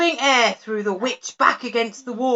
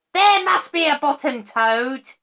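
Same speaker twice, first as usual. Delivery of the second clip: witch crying out, higher and louder; 7 semitones higher, 6 dB louder than usual. A narrator's reading. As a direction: insist loudly like an impatient witch. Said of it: "cried the witch".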